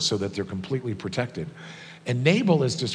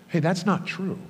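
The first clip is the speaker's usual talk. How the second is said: higher, as it dawns on her; retold realization; 8 semitones higher than usual. Daughter telling dad what dawned on her, retold realization. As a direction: voice a daughter realizing something, pitched up.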